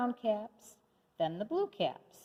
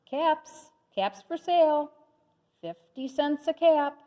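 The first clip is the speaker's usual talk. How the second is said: higher and louder hawker's call; street vendor calling; 6 semitones higher, 5 dB louder than usual. A narrator's reading. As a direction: call out your wares to the town. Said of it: "walked back to town calling".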